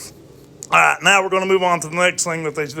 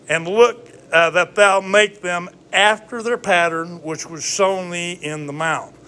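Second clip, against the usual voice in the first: slow, raised King James reading; scripture reading; 3 semitones higher than usual. Acted. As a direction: read the verse like scripture. Reads scripture aloud.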